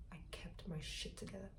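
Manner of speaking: soft unvoiced whisper, like a secret; whispered aside. Real, not acted. Whispering to viewers about keeping composure.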